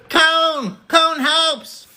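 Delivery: very high laughing cry; high cartoon cry. Joking with chat in a squeaky voice. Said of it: "laughing high character cry".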